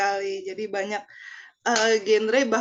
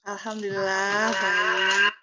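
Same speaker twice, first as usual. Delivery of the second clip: echoing; 2 semitones lower, slower than usual. Real, not acted. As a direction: voice echoing in a room. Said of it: "Suaranya menggema ya?".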